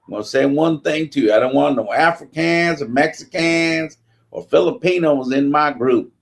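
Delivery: bully from Alabama welcoming recruits, mock menace; threatening Southern sailor. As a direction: lay down the law to new recruits. Alabama sailor voiced in story.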